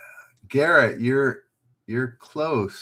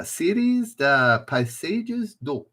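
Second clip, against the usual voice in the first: higher, slow, botched French; terrible French accent; 7 semitones higher, slower than usual. Shown as a demonstration. Quiz host retrying French painting titles, terrible French accent. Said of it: "that's a terrible French accent".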